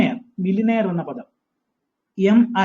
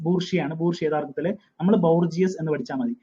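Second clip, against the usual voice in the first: ordinary talk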